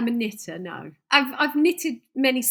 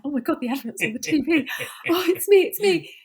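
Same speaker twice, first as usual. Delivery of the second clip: retold excitement; 5 semitones higher than usual. Retold thrill at seeing herself advertised.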